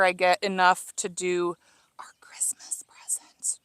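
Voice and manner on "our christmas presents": secretive unvoiced whisper. Real, not acted. Gardener whispering about Christmas presents to viewers, hushed whisper. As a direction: whisper so the grandparents won't hear. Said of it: "Why am I whispering?".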